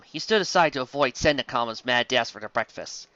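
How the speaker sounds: ordinary delivery, nothing marked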